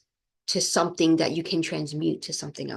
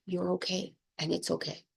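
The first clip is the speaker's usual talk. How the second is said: softer, tender self-comfort; quietly reassuring yourself; gentle reassurance; 8 dB quieter than usual. Retold or imitated. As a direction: say it softly to yourself like soothing a friend. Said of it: "just be like".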